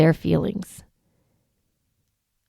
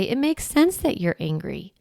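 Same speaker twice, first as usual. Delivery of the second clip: softer, gentle reassurance; calm the angry child softly; soothing validation; 4 semitones higher, 6 dB quieter than usual. Shown as a demonstration. Parent validating a mad child, soft and gentle. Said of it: "I love to say".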